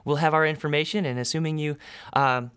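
the bubbly desk clerk greeting new arrivals; receptionist woman. Acted. GM as receptionist welcoming the test subjects, bright and chirpy.

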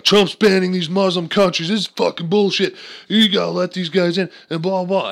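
high, loud mock outrage; whiny protester yelling; mock outraged protester. Mocking critics of the ban, high and loud. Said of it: "It's like".

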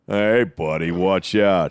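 low, gruffly macho cowboy warning; John Wayne. Retold or imitated. Straight guy warning men in locker room, mock cowboy voice. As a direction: drop the pitch for a tough-guy greeting. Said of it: "walking like John Wayne going".

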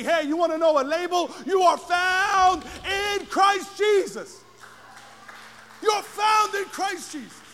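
loud, slow preaching, every line hammered; full-force preaching. Acted. Preacher proclaiming to the congregation, full-force preaching. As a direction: thunder it over the congregation. Preacher declares new label.

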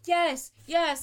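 imitating someone answering too surely; mock certainty. Speaker mimics a friend answering without knowing, mock certainty.

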